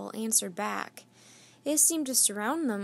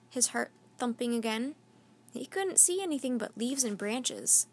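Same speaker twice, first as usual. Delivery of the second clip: ordinary delivery, nothing marked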